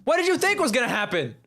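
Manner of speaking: high, exasperated teasing; incredulous. Real, not acted. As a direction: scold her, half laughing. Laughing scolding friend.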